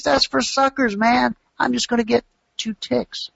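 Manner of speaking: high, eager voice of a scheming trader. Retold or imitated. Trader bragging about big contracts: high eager excitement.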